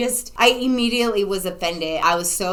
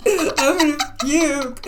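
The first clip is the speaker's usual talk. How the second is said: high, slow mock whine; hammed-up queasy misery; nauseated wail; 7 semitones higher, 4 dB louder, slower than usual. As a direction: moan it as if about to throw up. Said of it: "my stomach's all nauseated".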